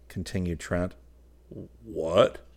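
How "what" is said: louder, rising, faltering question; feeble stammer. A narrator's reading. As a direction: falter as if caught out. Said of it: "stammered the feeble one".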